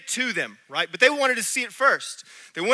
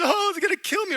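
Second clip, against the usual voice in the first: mock fear; 10 semitones higher, faster than usual. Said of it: "I could look at the giants as".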